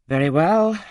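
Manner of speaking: emphatic agreement. A narrator's reading. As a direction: burst out in consent.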